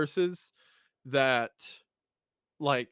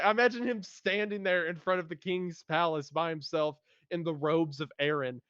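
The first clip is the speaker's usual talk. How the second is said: ordinary talk, nothing marked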